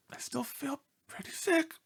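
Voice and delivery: weak sickly whisper; a dog laying it on, whispering; feeble whisper. Acted. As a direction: whisper to sound ill. Dog claims still sick.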